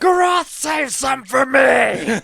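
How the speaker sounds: slow, loud call through laughter; laughing shout. Acted. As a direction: yell it across the battlefield. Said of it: "Can I shout?".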